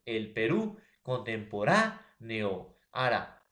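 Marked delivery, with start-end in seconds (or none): 1.0-2.8 contemporáneo slowed, RÁ lifted and held; stress on RÁ. Shown as a demonstration. Teacher giving a tilde example, stressing RÁ in contemporáneo. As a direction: lean on the RÁ in contemporáneo. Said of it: "la mayor fuerza de voz".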